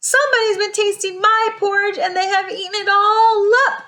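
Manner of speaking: high, quick little bear cry; very high wee voice, fast and upset. A narrator's reading. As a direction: squeal it as a tiny upset bear. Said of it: "cried a little wee bear in his little wee voice".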